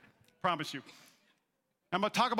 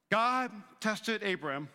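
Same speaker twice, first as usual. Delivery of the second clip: usual speaking voice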